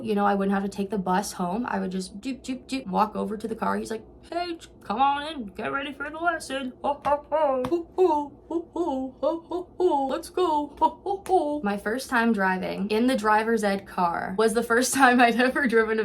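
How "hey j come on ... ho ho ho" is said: high, put-on voice hooting away; hammed-up high-pitched whooping greeting; jovial instructor impression. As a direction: imitate a hearty instructor calling a student over. Voices the Hulk Hogan lookalike instructor.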